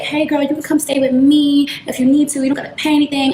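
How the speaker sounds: put-on high, sweet, inviting girlfriend voice; bubbly friend voice. Stripper friend inviting her to move in, high sweet voice. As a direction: lightly voice the friend being overly sweet. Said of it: "She was like".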